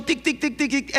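high, quick tic tic tic like a telegraph key; light clicking tic sounds; imitated telegraph ticking. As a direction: tap out Morse code with the voice. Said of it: "escuchó".

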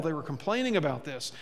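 ordinary delivery

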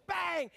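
shouted high bang like a crash; shouted bang cymbal. Pretend. Imitating a cymbal crash for the congregation, shouted. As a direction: hit it like cymbals.

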